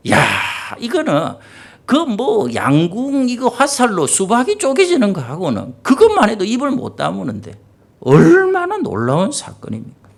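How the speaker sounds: ordinary delivery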